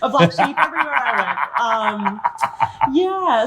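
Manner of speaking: said through a light laugh; chuckling at her own misfit past. Real, not acted. Guest jokes about being a misfit.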